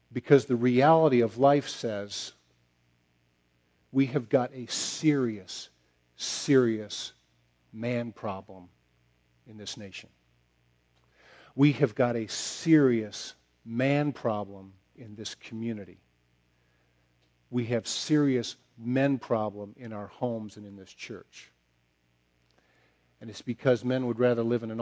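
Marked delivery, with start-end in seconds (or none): 0.1-16.0 slow, weighted words, each one hammered; slow emphatic preaching. Acted. Preacher hammers home point. 17.5-21.5 slow, stressed serious; slow emphatic preaching. Acted. Preacher repeats the point.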